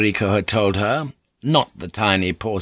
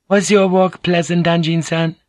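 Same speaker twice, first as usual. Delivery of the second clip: higher, louder gentle lady; Mariko's voice; 9 semitones higher, 4 dB louder than usual. Acted. Mariko greets Blackthorn, higher courteous lady's voice.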